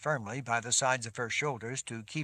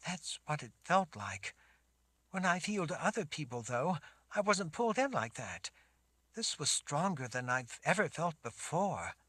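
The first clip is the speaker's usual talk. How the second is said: put-on high girl's voice, unsure; a young girl explaining hesitantly; shaken girl; 6 semitones higher than usual. Sammy answers Richard.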